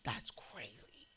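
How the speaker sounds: slow, whispered disbelief; hushed aside. Retold or imitated. Cousin joking quietly at a candlelight vigil, hushed. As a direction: draw it out under your breath in a whisper.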